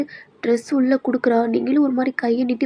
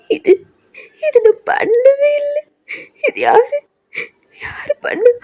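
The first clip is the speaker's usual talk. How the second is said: very high, tearful and slow; crying; 13 semitones higher, slower than usual. Acted. Girl sees texts she never sent, crying. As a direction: sob out the denial. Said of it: "அப்படின்னு நீங்க அழுதுகிட்டே இருக்கீங்க".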